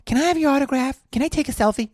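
high mock fan-girl voice; starstruck fan. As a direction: put on a high voice, asking for a favor. Voices the other airline lady as fan.

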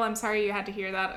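normal talking voice

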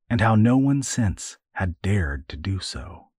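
normal talking voice

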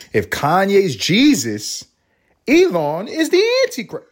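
a hammed-up conspiracy reveal; mock dramatic reveal. Podcast host tells listeners Elon is the Antichrist, mock dramatic.

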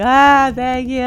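tearful thanks. Retold or imitated. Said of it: "And I'm just crying".

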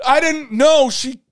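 very high, loud retold pleading; frantic self-defence, hammed up; exasperated protest. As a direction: plead innocence at the top of the voice.

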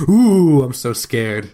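sarcastic pretend fear, unimpressed; mock scared. [name] brushing off Lori's intimidation, mock scared. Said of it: "does like a".

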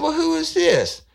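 high, put-on voice of the woman on the phone; old woman's voice. Woman answering a prank phone call, high put-on voice.

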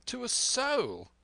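very high, drawn-out protest; crying out. A narrator's reading. Squire insists he kept the secret, crying out. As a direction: squeal the denial, slowly stretched. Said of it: "cried the squire".

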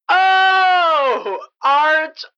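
loud overacted lament; hammy theatrical wail. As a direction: cry out like a bad Romeo. Hammed Shakespeare cry.

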